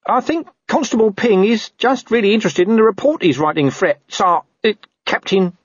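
high, squeaky careful explanation; anxious underling, pitched high; placating corporal. Acted. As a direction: soothe the boss nervously. Narrator voices Nobby placating.

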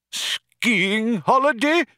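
high slow outraged cry; indignant cry. Acted. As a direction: cry out in disbelief. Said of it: "cried the old man".

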